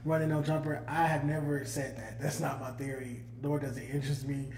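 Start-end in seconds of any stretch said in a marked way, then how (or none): none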